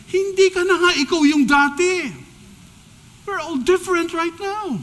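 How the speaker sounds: high, loud preaching cry; fervent exhortation, pitched high and loud; impassioned rebuke. Real, not acted. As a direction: press the point home with fervor.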